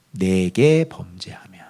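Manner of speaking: slow, hushed whisper of a divine threat; whispered warning. Acted. Preacher whispering God's warning to the congregation. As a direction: breathe it out as an ominous warning.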